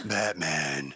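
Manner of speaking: low growled Batman. Shown as a demonstration. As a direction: drop low and growl the name. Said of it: "Batman voice".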